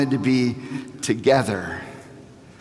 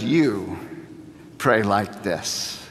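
slow and soft, every word spaced out; slow hushed; 7 semitones lower, 5 dB quieter, slower than usual. Acted. Preacher voicing Jesus to the congregation, slow and soft. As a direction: hush down and slow right down. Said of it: "But then Jesus says".

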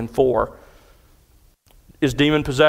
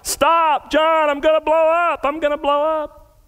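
panicked scream; 12 semitones higher than usual. Retold or imitated. Young woman screaming at the pastor mid-prayer, panicked shriek. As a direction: shriek it in sheer panic as if about to burst. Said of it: "she screamed out".

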